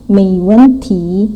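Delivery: slow "Meiwenti": rising mei, falling wen, rising ti; "Meiwenti" said slowly, syllable by syllable in tones. Shown as a demonstration. Teacher models "Meiwenti" slowly for the class. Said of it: "Mei, nada dua".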